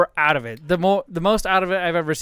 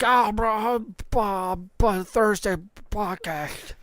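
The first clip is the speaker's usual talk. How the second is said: slow, drowsy mumble of a sedated friend; imitating a drugged friend, slow like an old man; dazed old-man voice; 3 semitones higher, 3 dB quieter, much slower than usual. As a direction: drag it out, half asleep. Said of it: "You sound like Joe Biden right now".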